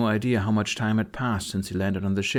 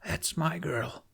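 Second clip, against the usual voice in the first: slow, softer purring reply; the dragon answering Astrid with affection; 6 semitones higher, 6 dB quieter, slower than usual. Acted. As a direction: purr the words like a contented beast. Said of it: "Chip purred as if to say".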